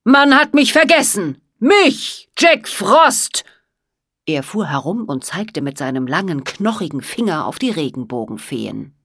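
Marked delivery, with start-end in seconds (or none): 0.0-3.5 much higher and louder, outraged; furious wizard. A narrator's reading. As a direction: make them feel the insult. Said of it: "röter und zorniger".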